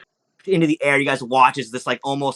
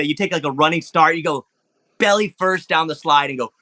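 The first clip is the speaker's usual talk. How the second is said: ordinary delivery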